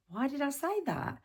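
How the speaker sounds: high-pitched, exasperated self-questioning; mock puzzled inner voice.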